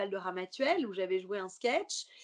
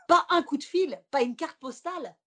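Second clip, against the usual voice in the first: high, loud play-acted indignation; mock reproach; 5 semitones higher, 8 dB louder than usual. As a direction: reproach him theatrically, pitched up. Mock reproach to Claude on stage.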